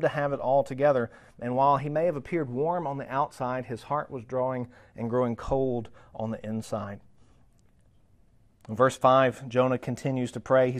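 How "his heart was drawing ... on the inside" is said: normal talking voice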